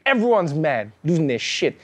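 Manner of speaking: ordinary talk, nothing marked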